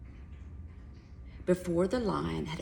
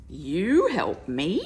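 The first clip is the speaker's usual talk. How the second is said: louder, slow scoffing laugh through the words; laughing lion; 8 dB louder, slower than usual. Acted. Lion laughing at the little mouse's promise. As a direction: roar it out laughing at the idea. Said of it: "roared the lion, laughing".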